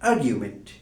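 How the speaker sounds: আর্গুমেন্ট as "AR-gyu-ment", built on "argue"; standard pronunciation. Shown as a demonstration. Teacher deriving the noun from argue, standard "AR-gyu-ment" pronunciation of আর্গুমেন্ট. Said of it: "noun ফর্মটি হবে".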